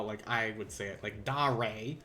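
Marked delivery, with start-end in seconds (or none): 1.2-2.0 da, re with a lazy southern American drawl on da; southern accent. Shown as a demonstration. Teacher shows her own Southern American take on da, re. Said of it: "God, that's so southern".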